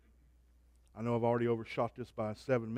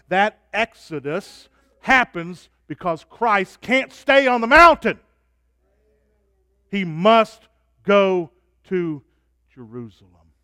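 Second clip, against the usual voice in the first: rising, louder preaching climax; preaching at full force; 5 semitones higher, 13 dB louder, slower than usual. Real, not acted. Preacher lifting the congregation to a climax. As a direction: drive it home from the pulpit.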